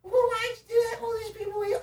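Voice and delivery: very high, loud mock whine; mocking a nag. Mimicking the friend who came upstairs to nag, mocking. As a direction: imitate a nagging friend in falsetto.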